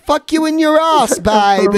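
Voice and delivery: squeaky mock-seductive voice; high silly voice. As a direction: coo it in a cartoonish falsetto.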